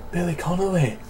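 put-on soft practice go at a Scottish accent. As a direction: try out a Scottish voice under your breath. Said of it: "in a Scottish accent".

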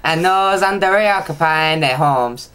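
badly faked Mexican accent; hammed-up amateur Mexican accent. Shown as a demonstration. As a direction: read it as a sing-song mock Mexican. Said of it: "I could do Mexican".

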